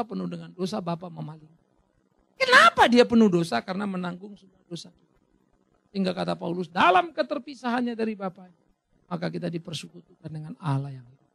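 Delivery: ordinary talk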